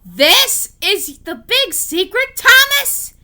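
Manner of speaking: high, loud, slow outraged shout; furious. Acted. James furious at Thomas over the buffers, high angry shout. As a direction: roar it out in disbelief. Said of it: "James grew furious".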